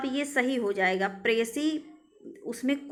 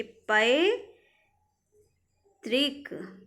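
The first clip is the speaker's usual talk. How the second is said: पैतृक broken as पै, long pause, तृक; very slow syllables; much slower than usual. Shown as a demonstration. Teacher asks students to look carefully, पैतृक in drawn-out parts. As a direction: stretch पैतृक, holding the first syllable. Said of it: "बहुत ध्यान से देखिए".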